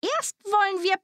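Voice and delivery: high-pitched witch voice; old witch. A narrator's reading. Witch luring Gretel to the oven, high witch voice. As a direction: announce it as the scheming witch. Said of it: "sagte die Alte".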